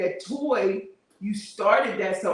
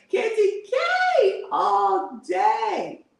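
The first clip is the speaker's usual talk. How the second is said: high, slow, laughing shout; drawn-out, delighted cheer; laughing hype; 10 semitones higher, much slower than usual. Real, not acted. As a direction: cheer her name through the laughter. Hyping a viewer's name.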